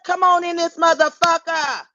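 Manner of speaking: high, hyped-up come on in; excited welcome. Real, not acted. Streamer hyping viewers into her surprise live, high and excited. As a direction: throw the doors open for the crowd.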